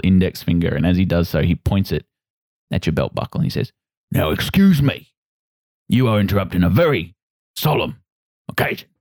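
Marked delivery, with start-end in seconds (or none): 4.1-8.9 stern dwarf captain. Acted. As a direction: draw yourself up and scold him as the proud dwarf captain. Dwarf captain scolds the tall stranger.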